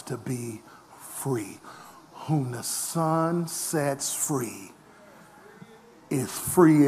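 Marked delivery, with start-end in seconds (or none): none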